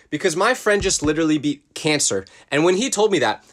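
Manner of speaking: ordinary delivery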